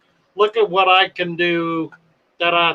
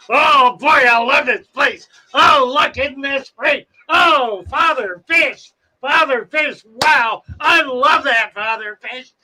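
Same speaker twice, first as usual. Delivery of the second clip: put-on excited kid; 7 semitones higher than usual. Teen boy rolling into the fish store, excited high shouting. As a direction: gush over every tank like a thrilled teen. Teen customer gushing in shop.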